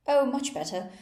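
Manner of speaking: queen cries out to Alice, loud and relieved; cried out. A narrator's reading. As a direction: blurt it out with relief. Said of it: "cried the queen".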